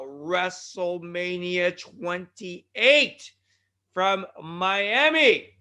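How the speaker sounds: slow, stretched event announcement; announcer hype. Acted. Host announcing the old-school event, drawn-out announcer hype. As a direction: draw out each word like a ring announcer.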